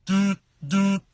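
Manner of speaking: loud droning phone doots; phone buzzing imitation. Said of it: "my phone's just going".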